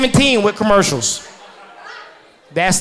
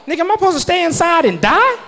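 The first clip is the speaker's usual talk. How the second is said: ordinary talk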